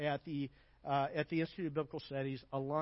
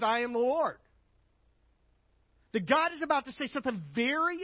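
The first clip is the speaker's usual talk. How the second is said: loud, higher preaching push on the Lord; emphatic preaching; 6 semitones higher, 4 dB louder than usual. Acted. Preacher to church, hammering I am the Lord loudly. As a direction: proclaim it to the congregation.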